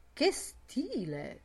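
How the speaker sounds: slow, drawn-out admiration; impressed, lingering approval; approving. Acted. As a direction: savour it, pitched a little up. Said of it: "approvando il rombo profondo".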